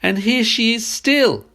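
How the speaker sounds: high, loud, slow delighted cub; joyful squeak. A narrator's reading. Baby bear finds Goldilocks asleep, joyful squeak. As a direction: squeal it out with glee. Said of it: "squeaked the baby bear joyfully".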